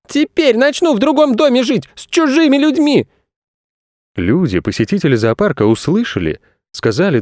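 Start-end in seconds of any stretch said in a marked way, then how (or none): 0.1-3.1 put-on high squealed child's threat; boy shouting. A narrator's reading. Boy threatening to leave home, shouting. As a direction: yell in a much higher boy voice. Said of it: "крикнул Федя".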